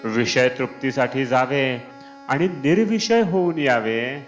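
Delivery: ordinary talk, nothing marked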